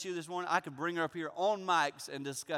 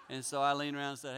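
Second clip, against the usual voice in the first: ordinary talk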